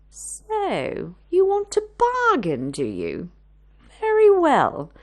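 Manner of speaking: a haughty haggler pouncing; loud, teasing, grand; sly bargaining. Acted. Mrs Larchmont haggles.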